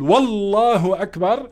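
والله أكبر with an added و at the start; wrong pronunciation with wa. Shown as a demonstration. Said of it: "هول كلهم ما بيصحوا".

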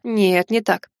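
ordinary delivery, nothing marked

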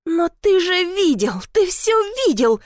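high-pitched outraged accusation; trembling with indignation. A narrator's reading. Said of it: "Её голос дрожал от возмущения". (возмущённо, высоким голосом)

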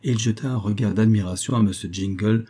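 plain reading voice

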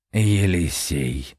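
low, slow, savouring the name; slow ominous master. A narrator's reading. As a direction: try the name out coldly.

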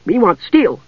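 high, demanding creature voice; high-pitched little dwarf haggling; disdainful sniff. Acted. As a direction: hold out for better pay. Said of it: "he sniffed".

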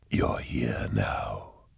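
low, very slow breathy whisper; eerie unseen voice. A narrator's reading. Demon voice greeting a man lost in dust, eerie whisper. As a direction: draw it out in a deep, soft whisper.

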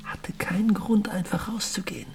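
high tense whisper; urgent whispered insistence. Acted. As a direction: insist under the breath. Hushed argument at night.

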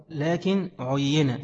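لكن عين read very slowly with every short vowel clear, lakin ʕuyyina; careful vowelled reading. Shown as a demonstration. Teacher to students, slow fully vowelled لكن عين. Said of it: "شكلوها صح عشان تنطقوها صح".

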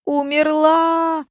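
long, high-pitched wailing cry; yelled wail. A narrator's reading. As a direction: stretch it out in mock despair. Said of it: "заорал мой ухажёр". (истошный протяжный вопль)

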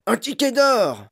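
high, loud shopkeeper's yell; astonished yell. A narrator's reading. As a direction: yell it out in amazement. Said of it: "hurla le boutiquier".

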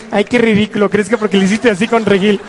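sneering little voice, dragging out phrase endings; taunting inner voice. Acted. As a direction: play the nagging fear that mocks and threatens. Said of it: "la vocecita va a decir".

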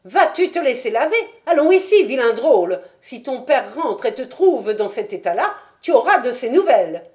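put-on high, loud call after a runaway child; a stout mother shouting at her son. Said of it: "cria-t-elle".

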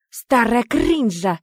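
slow, drawn-out realisation; spoken in chorus. A narrator's reading. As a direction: stretch out each word as it dawns. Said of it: "хором сказали они".

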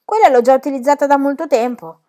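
lightly voiced child, undaunted; cheeky retort. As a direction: answer back matter-of-factly and firmly. Child retorts to exasperated teacher.

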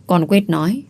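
usual speaking voice

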